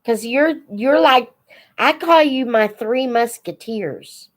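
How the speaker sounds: ordinary talk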